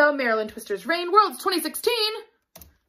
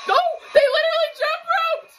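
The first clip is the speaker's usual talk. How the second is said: excited; 4 dB louder than usual. Real, not acted. Amazed at the routine.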